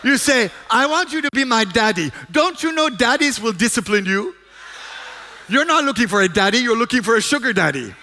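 loud, high sermon delivery, driving each question home; fervent preaching. Acted. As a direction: preach it at full force to the congregation. Preaching with jokes.

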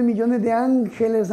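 ordinary delivery

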